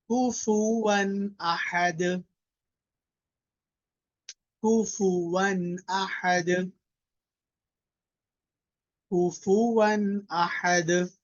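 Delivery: slow, melodic tajweed recitation with a long held final vowel; measured chanting recitation, the last word drawn out; Quranic recitation. Shown as a demonstration. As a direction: recite it slowly for the class to echo. Teacher recites for class to repeat.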